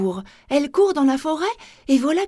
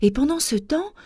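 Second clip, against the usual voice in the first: ordinary delivery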